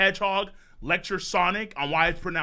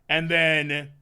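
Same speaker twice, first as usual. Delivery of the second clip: normal talking voice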